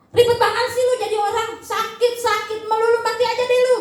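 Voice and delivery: sarcastic partner grumbling at the sick one; annoyed spouse. Retold or imitated. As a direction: act out a fed-up spouse complaining. Uncaring spouse scolding sick partner.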